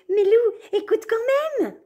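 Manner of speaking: very high squeaky coaxing; coaxing mouse. Acted. Mouse urges the wolf to listen, very high. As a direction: coax him like a tiny mouse.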